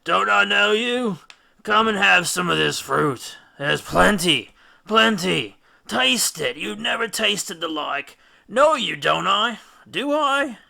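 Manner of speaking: put-on high, loud drunken slurring; slurred words. A narrator's reading. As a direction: slur it like a man dazed by the fruit. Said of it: "his words slurred a little".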